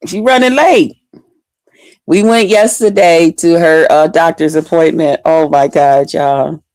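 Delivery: ordinary talk, nothing marked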